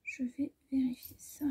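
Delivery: unvoiced murmur to herself; whisper. Real, not acted. Sewer measuring fabric, whispering to herself. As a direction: breathe it while measuring.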